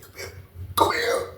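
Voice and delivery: voiceless, whispered beckoning; secretive whispered order; hushed summons. Retold or imitated. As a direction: call someone over under your breath. Old head secretly summons him over.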